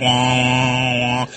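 low, monotone wah-wah drone; droning garbled-speech imitation. Patient imitating doctor's words after diagnosis, droning. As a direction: imitate muffled, meaningless talk as a drone. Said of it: "everything he said after that sounded like".

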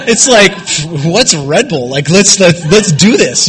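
loud, high let's-go energy; rally the crew to party; pumped up. Retold or imitated. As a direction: drunk hype. Revving up the night.

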